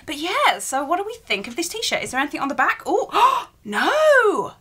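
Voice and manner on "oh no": high, gasping "oh no"; surprised gasp. Real, not acted. To viewers, realising she showed the back, gasping. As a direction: catch your breath at the mistake.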